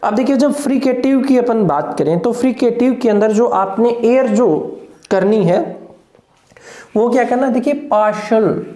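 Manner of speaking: usual speaking voice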